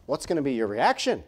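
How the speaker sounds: ordinary delivery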